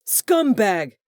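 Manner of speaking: high, loud insult yell. A narrator's reading. Corbin yelling at Hugh as Delaney comes out, loud yell. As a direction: spit the word out as a yell. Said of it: "Corbin yelled".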